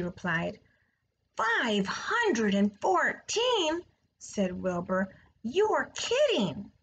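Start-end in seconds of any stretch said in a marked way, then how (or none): 1.4-3.8 high, slow amazed question; a pig who can't believe the number; astonished. A narrator's reading. Wilbur astonished at egg count. 5.4-6.7 disbelief. A narrator's reading. Wilbur astonished at egg count.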